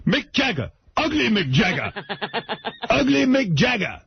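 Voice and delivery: high, sing-song street heckler calling out; mock heckler call. Imitating the homeless guy yelling at him, high mock call. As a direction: call it out across the street, mocking. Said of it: "imitating the homeless guy again".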